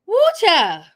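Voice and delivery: loud excited woo. Real, not acted. Reacting to clip loudly.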